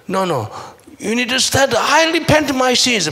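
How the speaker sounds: high, loud retold outcry; emphatic repentance. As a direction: imitate an urgent, loud confession. Retold man rises and repents himself. (높고 큰 소리로 회개를 외치는 흉내)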